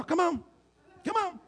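high, soft mock taunt, lightly voiced; mock Goliath taunt. Preacher mock-voicing Goliath as a squeaky taunter. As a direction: tease like a squeaky giant. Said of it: "could you imagine Goliath out there".

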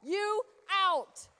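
loud, high, rising preacher's call; fiery preacher calling out; preaching shout. Real, not acted. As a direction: build it up and belt it out.

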